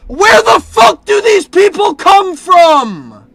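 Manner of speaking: loud, high incredulous shout; exasperated full-volume outburst; losing it. Real, not acted. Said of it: "I'm losing my shit now".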